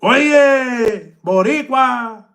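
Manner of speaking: high, drawn-out Puerto Rican Spanish greeting; Puerto Rican Spanish accent. Retold or imitated. Showing off his Puerto Rican Spanish to the chat, in a high Puerto Rican Spanish accent. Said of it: "You heard me with the Paisa stuff".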